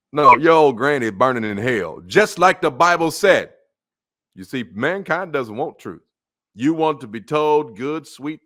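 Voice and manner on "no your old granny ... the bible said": fiery denunciation. Real, not acted. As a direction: thunder it from the pulpit. Preacher condemning the granny.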